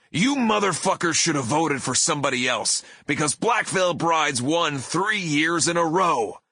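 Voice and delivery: high, pushed heel-promo taunt; pro wrestler bellow. Retold or imitated. Singer taunting booing ticket buyers after winning, wrestler bellow. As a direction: bellow at the crowd like a wrestling villain. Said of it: "bellowed in my best professional wrestler voice".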